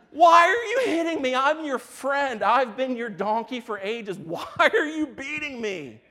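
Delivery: high, very loud hammed-up wail; outraged donkey. Donkey to the master beating it, shrieking hurt protest. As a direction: plead at top volume as a wronged animal. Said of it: "the donkey's like".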